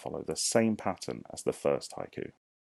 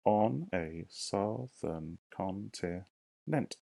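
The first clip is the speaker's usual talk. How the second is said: drawn out, syllable by syllable; slow syllable count; much slower than usual. Shown as a demonstration. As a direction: count it out slowly. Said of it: "teacher counts syllables aloud".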